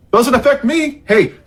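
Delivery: high, breezy shrug of a line; mock indifference. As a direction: toss it off like it's nobody's problem.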